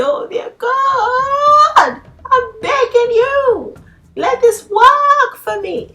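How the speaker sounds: hammed-up sobbing plea to God; mock crying yell. Mocking Christians who beg God instead of commanding, crying shout. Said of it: "Stop yelling".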